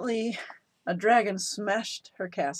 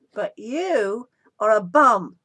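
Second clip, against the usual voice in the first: slow, louder on bum; blunt put-down; 2 semitones higher, 8 dB louder, slower than usual. A narrator's reading. Princess bluntly insulting the prince, slow and louder. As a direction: land the insult flat and deliberate. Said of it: "said Elizabeth".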